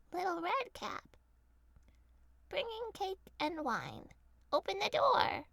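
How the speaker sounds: mock girlish voice; wolf faking girl voice. A narrator's reading.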